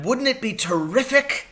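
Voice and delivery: high, thrilled exclamation; excited shout. A narrator's reading. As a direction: sell the wild idea. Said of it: "he shouted".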